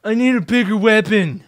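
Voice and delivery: exasperated cry at losing; frustrated shout. Real, not acted. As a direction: yell it in exasperation. Frustrated after dying in game.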